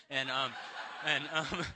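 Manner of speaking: helpless laugh between filler words. Real, not acted. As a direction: try to carry on through real laughter. Cracks up after his own impression.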